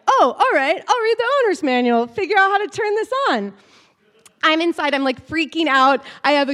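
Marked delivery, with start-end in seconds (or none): 0.1-3.5 higher, quick and breezy; breezy, nonchalant boyfriend voice; outwardly casual. Retold or imitated. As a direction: toss it off like it's nothing. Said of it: "externally, he was just like".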